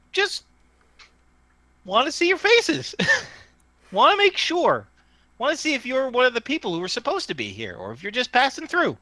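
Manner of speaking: high, folksy little working-Joe voice; small tinny voice. Acted. Tree voice explains itself to strangers, tinny. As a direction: keep it tiny and high-pitched, casual. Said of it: "tinny voice comes from the tree".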